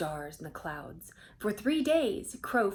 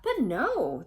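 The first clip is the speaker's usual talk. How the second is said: higher, louder shouted "But no"; 4 semitones higher, 6 dB louder than usual. Acted. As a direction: cry it out as a crowd of animals. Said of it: "the animals shouted".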